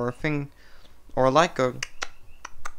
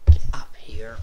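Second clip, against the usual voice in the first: hissed command under the breath; sharp whisper; 4 semitones lower, 5 dB louder than usual. Real, not acted. Hissed at the pet interrupting story time.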